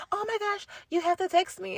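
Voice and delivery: high, soft, breathy-bubbly gushing; gushing fan voice. Retold or imitated. Imitating new friends begging her to text, high and gushy. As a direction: mimic people begging for your number.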